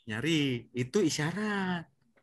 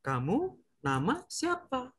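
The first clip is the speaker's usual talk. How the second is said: Kamu nama siapa? said slowly, softer; slow demo question; 5 semitones higher, 5 dB quieter, slower than usual. Shown as a demonstration. Teacher models the sign question for children, slowly. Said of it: "itu isyarat".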